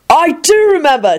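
high, eager shout; a high gleeful yell; enthusiastic shout. Acted. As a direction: yell it, thrilled. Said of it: "Jamie shouted enthusiastically".

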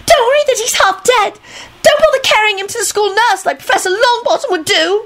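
very high sarcastic tirade; high-pitched girl's furious protest; indignant scream. A narrator's reading. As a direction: keep shrieking in outraged sarcasm. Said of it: "Esther screamed".

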